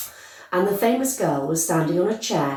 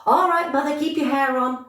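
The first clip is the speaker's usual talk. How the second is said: shouted retort; 9 semitones higher than usual. Acted. Girl shouting back at her mother, high and cheeky. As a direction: brush mother off with a pitched-up shout. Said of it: "Miss Beauregard shouted".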